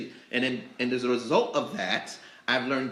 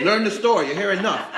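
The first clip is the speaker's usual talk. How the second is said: loud, raised-pitch scolding imitation; gruff dad; 7 semitones higher, 9 dB louder than usual. Dad sending a regular off to search, gruffly. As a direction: bark it like a fed-up store owner. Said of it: "He was gruff in his love".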